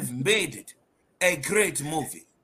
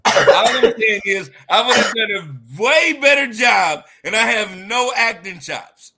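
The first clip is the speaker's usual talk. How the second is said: loud; 6 semitones higher, 8 dB louder than usual. Real, not acted. Insists she'd do better.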